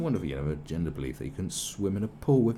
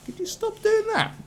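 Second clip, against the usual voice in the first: mock falsetto plea; 4 dB louder than usual.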